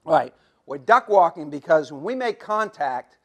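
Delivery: ordinary delivery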